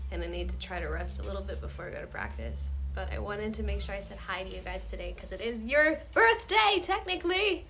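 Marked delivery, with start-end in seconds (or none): 5.4-7.6 high, loud birthday cheer; excited. Real, not acted. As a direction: sing out the good news, drawn out. Birthday greeting to fans.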